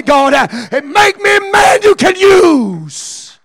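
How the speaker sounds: high, loud preaching with gasped uh's; shouted preaching. Acted. Preacher exhorting the Pentecost congregation, shouting high and loud. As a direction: preach it to the rafters.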